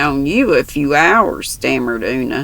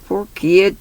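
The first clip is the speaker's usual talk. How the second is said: ordinary delivery, nothing marked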